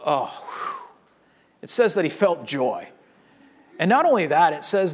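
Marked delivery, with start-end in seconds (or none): 0.1-0.9 soft exhaled oh, whew of relief; imitating a quiet relieved exhale. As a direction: breathe out the oh, whew as tension drains. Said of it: "he goes".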